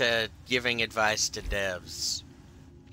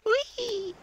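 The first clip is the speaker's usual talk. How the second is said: normal talking voice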